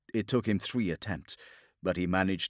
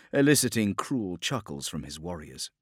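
plain reading voice

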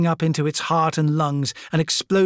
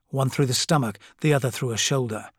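ordinary delivery

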